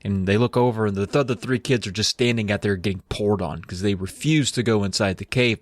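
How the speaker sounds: ordinary talk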